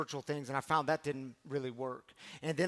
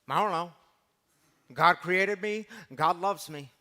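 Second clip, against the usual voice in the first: put-on child's answer; 7 dB louder than usual. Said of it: "You ask him, Bricks".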